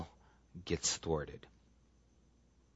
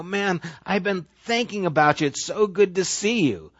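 a touch higher, glad burst; excited reunion; 7 semitones higher than usual. Retold or imitated. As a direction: run up and greet an old friend. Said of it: "and go".

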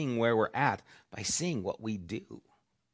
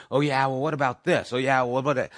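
mock argumentative; 4 semitones higher, 8 dB louder, faster than usual. Mock argumentative comeback quote.

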